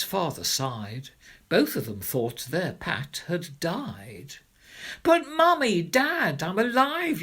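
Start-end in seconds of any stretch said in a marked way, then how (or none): 5.0-7.2 put-on very high, loud child's eager cry; excited child. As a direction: burst in delighted to be alive. Child surprising grieving parents.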